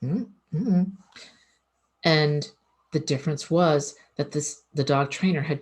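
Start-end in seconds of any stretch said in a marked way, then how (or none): 0.0-0.9 short higher-pitched "mm, mm" imitating a dog; two little closed-mouth hums as the big dog; imitated dog hum; big dog's unbothered hum. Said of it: "The big dog's like".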